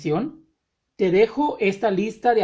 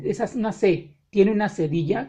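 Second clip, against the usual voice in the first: ordinary delivery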